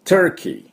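Turkey high, short ter-ki; English Turkey. Shown as a demonstration. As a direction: model Turkey brightly up high. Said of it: "こんな感じです".

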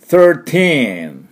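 put-on "thirteen" slow, with a long r and long teen; long r-coloured first syllable in "thirteen"; drawn-out thir. Shown as a demonstration. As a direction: stretch "thirteen" carefully for learners. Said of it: "thirと引いてきます。".

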